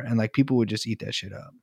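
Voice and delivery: usual speaking voice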